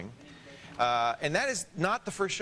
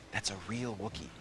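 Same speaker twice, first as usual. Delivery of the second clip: soft, voiced That's a real Wookiee; hushed but voiced aside; 7 semitones lower, 8 dB quieter than usual. Real, not acted. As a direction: keep it low and confiding. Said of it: "That's not a fake Wookiee".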